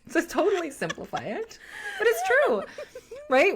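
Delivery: talking through real laughter, pitch jumping up high; laughing. Real, not acted. Cohost laughing at her own oversimplification, laughing. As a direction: laugh it out while admitting you've oversimplified.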